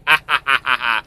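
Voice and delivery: laughing at own joke; short spoken-pitch ha-ha laugh; chuckle. Real, not acted.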